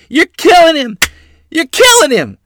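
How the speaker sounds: frantic mother's scream, very high and loud; shrieking. A narrator's reading. Mother runs out to the beating, shrieking. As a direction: shriek it twice in horror. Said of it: "Mother shrieked".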